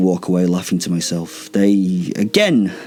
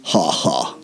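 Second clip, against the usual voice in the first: low, humourless spoken ha ha; lower, deadpan mock laugh; 5 semitones lower than usual. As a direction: say the laugh as words, without laughing. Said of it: "did not laugh the ha ha, but actually spoke it".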